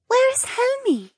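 put-on light, girlish and curious; young mermaid voice.

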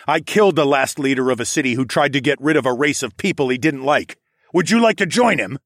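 threaten, pushing hardest on the last question; snarled threat. A narrator's reading. Hero facing down the polar bear, snarled threat. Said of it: "I snarled as I faced the polar bear".

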